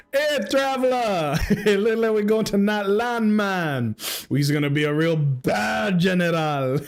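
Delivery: high, hammed-up Jamaican accent; mock Jamaican accent, loosening into laughter. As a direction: put on a comic Jamaican game-companion voice. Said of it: "Do it with the Jamaican accent".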